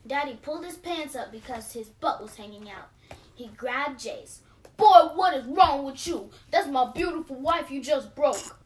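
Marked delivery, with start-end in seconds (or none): 4.8-8.6 much louder, anguished dad; father shouting over his broken wife; angry cry. A narrator's reading. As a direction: cry out in outrage. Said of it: "he cried".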